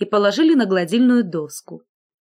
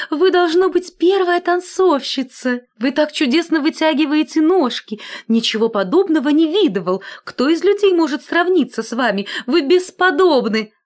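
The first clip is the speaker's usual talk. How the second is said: much higher, eager admiring voice; gushing flattery; 7 semitones higher, 3 dB louder than usual. A narrator's reading. Collar gushing over the scissors, much higher. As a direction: pour on the compliments.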